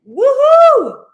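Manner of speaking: joyful high whoop; cheer. Pretend. Coach cheering herself on with a loud high whoop. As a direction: whoop it out like a celebration. Acting out joyful self-talk.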